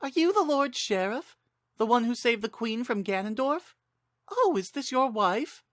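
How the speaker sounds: high, politely curious stranger; a stranger on the ship politely asking; woman traveler. Acted. Woman on the ship asking Link, high and polite.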